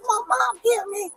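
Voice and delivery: high-pitched imitation of her son calling; imitating a little boy crying out for mom; child calling out. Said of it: "My son was screaming for me".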